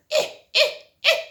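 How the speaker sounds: put-on high, loud baby whimpers; baby bear whimper. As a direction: squeal like a tiny bear cub. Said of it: "Baby Bear went".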